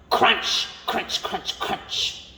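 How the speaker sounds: crunch, crunch whispered and fading. A narrator's reading. Reading a bedtime story, whispered crunching. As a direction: let the crunching sound die into a whisper. Munching sounds, hushed.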